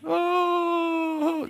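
high, drawn-out mourning wail; a short wail like a hired mourner; mourners' wail. Retold or imitated. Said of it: "they wail".

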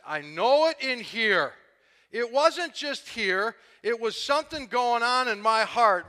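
loud, building preacher's fervor; preaching at full force. Acted. Pastor stirring the church about his conversion, fervent loud preaching. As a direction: pour it out to the congregation with rising passion.

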